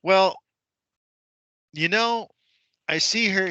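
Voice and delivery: lightly voiced stern judge. Judge voiced in retold court story.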